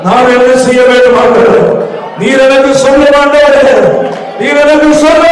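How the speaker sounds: urgent pleading prayer; fervent loud prayer. Acted. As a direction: cry out in prayer, climbing.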